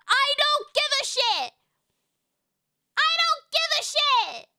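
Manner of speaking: high-pitched furious outburst; angry yell. Real, not acted. Dismissing objections about Nevada, angry high-pitched yell. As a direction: yell it high, each word hammered. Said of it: "I'm so angry".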